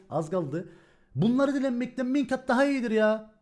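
ordinary delivery

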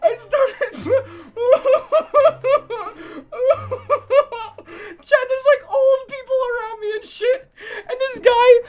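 squeaky breathless real laughter; laughing hard. Real, not acted. Streamer to chat, retelling, helpless high laughing. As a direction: laugh helplessly through the words. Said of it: "I was laughing so much".

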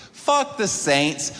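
each word dragged out slowly; slow defiant opener. Retold or imitated. Comic to New Orleans crowd, slow defiant taunt. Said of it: "My opening line in New Orleans".

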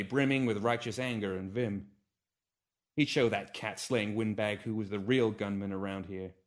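plain reading voice

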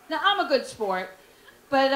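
usual speaking voice

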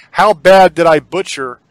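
ordinary delivery

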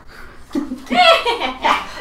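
high shrieking witch's cackle. Pretend. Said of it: "I wanna try my best witch cackle".

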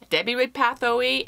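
ordinary delivery

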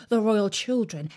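ordinary delivery, nothing marked

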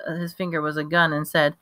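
plain reading voice

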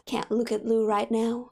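ordinary talk, nothing marked

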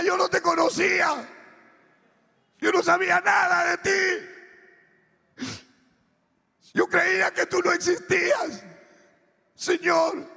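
very high, loud cry to God; anguished plea. Retold or imitated. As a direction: shout your confession in desperation. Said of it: "Y yo le decía".

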